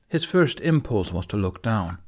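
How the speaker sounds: ordinary delivery, nothing marked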